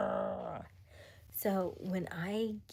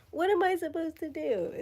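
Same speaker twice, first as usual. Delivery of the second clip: exasperated; 12 semitones higher, 7 dB louder than usual. Real, not acted. Exasperated about being called cute.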